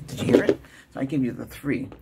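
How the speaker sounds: ordinary talk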